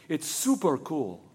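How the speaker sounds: slow, laid-back cool with a laugh; super cool. A narrator's reading. Girl tells Sam about the cannonball, slow and cool. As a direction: drawl it out coolly, amused. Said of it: "as cool as the way she had said".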